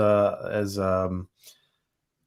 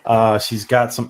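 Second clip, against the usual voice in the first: ordinary talk, nothing marked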